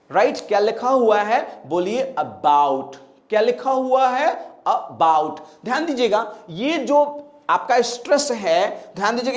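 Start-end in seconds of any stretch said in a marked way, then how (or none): 2.2-2.9 "about" slow, low, weight on "bout"; short "uh", then press on "bout" in "about"; stress on second syllable. Shown as a demonstration. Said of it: "उसके बगल वाले sound पे हम pressure देंगे". 4.5-5.4 "about" slow, low, weight on "bout"; short "uh", then press on "bout" in "about"; stress on second syllable. Shown as a demonstration. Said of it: "उसके बगल वाले sound पे हम प्रेशर देंगे".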